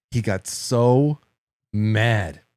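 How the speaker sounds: long, stretched "so", then "mad" set apart; slow weighty stress on "so" and "mad"; drawn-out emphasis. Real, not acted. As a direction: stretch "so" and pause before "mad".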